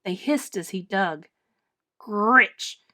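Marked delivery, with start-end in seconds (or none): 2.0-2.8 loud harsh creature snarl; snarling nizzards. Acted. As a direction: spit it out like angry birds. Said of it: "snarled the nizzards".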